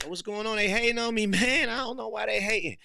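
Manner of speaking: high, whiny mock outrage with a laugh; mock envious complainer. Envious man complaining people hate on him, high mock whine. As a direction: play the jealous guy crying foul. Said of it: "Now you get envious and like".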